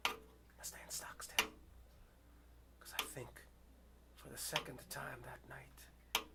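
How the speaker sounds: whispering in terror; frightened whisper. Real, not acted. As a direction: barely breathe the words out, afraid to move. Frozen before a snarling dog.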